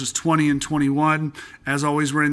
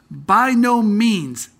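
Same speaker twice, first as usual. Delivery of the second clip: slow, high and loud By no means!; emphatic exclamation; 6 semitones higher, 5 dB louder, much slower than usual. Shown as a demonstration. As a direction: stretch out the no big and loud. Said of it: "I'd shout it at you".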